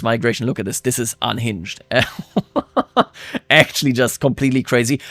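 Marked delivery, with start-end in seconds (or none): 1.8-3.0 high, incredulous laugh; real high-pitched chuckling. Real, not acted. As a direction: laugh at how absurd it is. Laughs at unhinged migration numbers.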